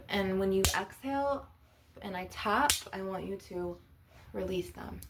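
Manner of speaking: soft, spaced-out guided meditation voice; hushed healer leading a visualization. Acted. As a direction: guide them gently through a release, pausing between phrases. Leads an energy release meditation.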